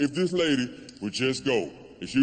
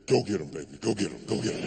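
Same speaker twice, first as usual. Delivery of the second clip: low whiskey voice urging him forward; low-pitched boozy encouragement; Jack Daniels egging on; 5 semitones lower, 3 dB quieter, faster than usual. Acted. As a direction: goad him on in a lowered, confidential voice. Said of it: "that Jack Daniels said".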